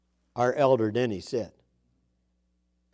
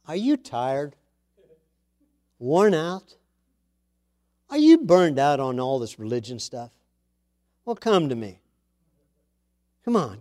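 voicing Jesus' invitation, rising higher on key phrases; a lightly voiced Jesus, inviting; scripture reading; 4 semitones higher than usual. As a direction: read it as Christ gently calling the weary. Reads Jesus' words from Matthew.

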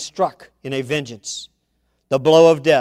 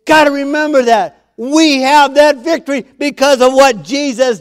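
full-force preaching; 11 semitones higher, 11 dB louder than usual. Real, not acted.

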